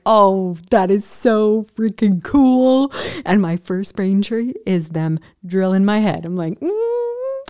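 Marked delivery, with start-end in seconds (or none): 0.1-2.9 emphatic, drawn-out enthusiasm; awed delight. Retold or imitated. Movie fan reacting to skull drilling, slow awed delight. 6.6-7.4 long high-pitched "mmm"; high humming noise. Retold or imitated. Patient having her head drilled, high hum.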